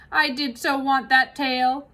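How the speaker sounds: big kitchen woman grumbling about the fox; Mabel voice. Acted. Mabel disappointed.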